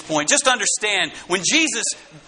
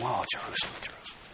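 wailing lament; 7 semitones lower, 12 dB quieter, much faster than usual. Acted. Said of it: "He had been wailing".

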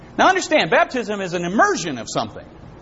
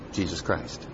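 hushed reverence; 13 semitones lower, 10 dB quieter, slower than usual. Real, not acted. Hushed answer to what saves.

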